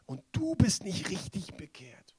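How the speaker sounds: voiceless accusing whisper; whispering Satan. Retold or imitated. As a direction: breathe the accusation like a tempter. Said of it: "dann sagt der Satan".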